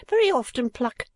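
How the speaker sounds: high-pitched, emphatic agreement; echoing sidekick. A narrator's reading. Second gentleman backing up the first, high echoing voice.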